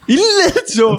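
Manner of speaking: very high astonished question; incredulous echo. Real, not acted. S1 echoes the ledtog accusation, incredulous. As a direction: squeal it back in disbelief.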